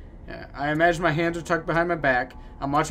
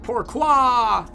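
high shouted French lament; mock French cry; 7 semitones higher, 4 dB louder than usual. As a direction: wail it like a tragic Frenchman.

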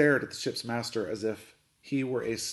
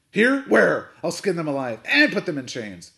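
loud, high-pitched angry outburst; furious; 6 semitones higher, 9 dB louder than usual. A narrator's reading. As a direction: fire off outraged shouts. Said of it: "In his fury".

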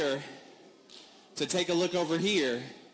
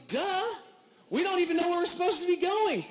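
high mock-exasperated "Duh"; sarcastic duh; 10 semitones higher than usual. Tired dad answering the cleaner, mock sarcastic. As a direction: play it as a teen rolling their eyes.

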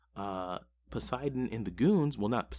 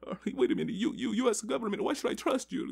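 much higher, hammed-up suspicious caller; imitating the ISIL leader; 12 semitones higher than usual. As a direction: mimic a wary man on the phone, pitched way up. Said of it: "he's like".

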